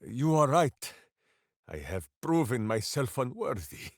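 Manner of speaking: normal talking voice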